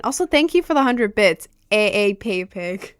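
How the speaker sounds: loud, bright streamer shoutout; excited thanks. Real, not acted. Thanking a viewer for a hundred bits, excited and loud. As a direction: call out the thanks with energy.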